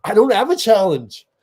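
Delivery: high, loud protest; mock-exasperated coach snapping back loudly; exasperated coach impression. Acts out the coach's reaction.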